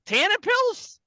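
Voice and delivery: high disbelieving question about "Tannin pills"; incredulous. Real, not acted.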